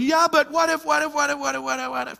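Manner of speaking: spiral anxiously, trailing away; mock panic. Preacher imitating an anxious person's endless what-ifs, fading. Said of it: "same conversation".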